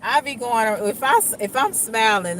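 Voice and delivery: ordinary delivery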